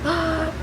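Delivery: high, sharp gasp; shocked gasp. Retold or imitated. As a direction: echo their shock with a gasp. Acting out both shocked reactions.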